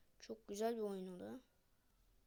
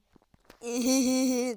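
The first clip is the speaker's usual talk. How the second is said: loud laugh; 3 semitones higher, 18 dB louder than usual. Real, not acted. Streamer laughing loudly at an annoying sound. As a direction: burst of loud, exasperated laughter.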